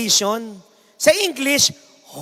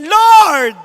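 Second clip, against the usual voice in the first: angry shout; 5 semitones higher, 9 dB louder than usual. Acted. Angry old woman shouting for the Lord. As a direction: yell Lord at full volume, furious.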